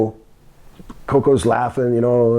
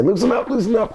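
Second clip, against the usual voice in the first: high, repeated plea; Coco; 8 semitones higher than usual. Retold or imitated. Coco pleading with the boss, high imitation. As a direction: mimic a trapped man's high protest. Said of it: "Coco was saying".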